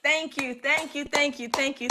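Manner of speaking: usual speaking voice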